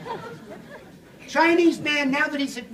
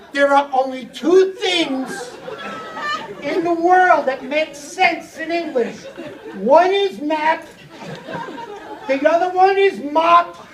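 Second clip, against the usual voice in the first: slow, deliberate, each word set apart, map and mop stressed; slow teaching emphasis; 4 semitones higher, slower than usual. Shown as a demonstration. Teacher spelling out map versus mop for the clerk, slowly. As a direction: lay it out slowly like a patient teacher. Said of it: "teacher spells out map and mop".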